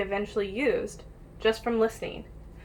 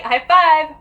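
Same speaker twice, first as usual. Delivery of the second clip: cheerful praise; 6 semitones higher, 12 dB louder than usual. Real, not acted. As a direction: cheer them on for getting it right. Praising children for right answer.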